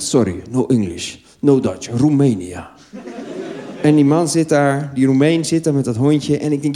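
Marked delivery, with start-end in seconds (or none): 0.0-2.7 lower, slow broken English; lightly voiced foreign stranger; Romanian man. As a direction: play a Romanian who barely speaks English. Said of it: "hij zegt".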